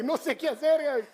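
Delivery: a husband in a panic after the crash; panicked plea. Retold or imitated. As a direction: blurt it out in a panic to his wife. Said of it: "me pongo bien nervioso".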